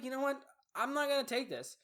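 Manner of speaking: high-pitched retold indignation; fed-up defiance. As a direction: act out having had enough. Acts out standing up to bully.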